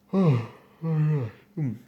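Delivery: a real, drawn-out yawn with a hum; sleepy voiced yawn. Real, not acted. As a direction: yawn through the sound. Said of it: "sorry for the yawn".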